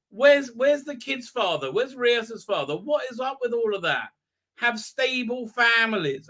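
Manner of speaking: usual speaking voice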